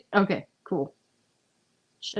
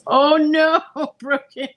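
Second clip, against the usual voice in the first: laughing; 8 semitones higher, 10 dB louder, slower than usual. Real, not acted. Laughing at the pool.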